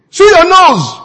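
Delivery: high, loud jeering voice; mock taunt. Social media user taunting the president, loud jeer. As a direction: jeer at him like a troll.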